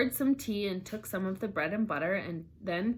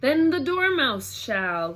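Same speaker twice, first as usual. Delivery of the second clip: higher, louder call, drawn out; cried out; 6 semitones higher, 7 dB louder, slower than usual. Acted. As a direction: call out eagerly together. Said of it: "they both cried".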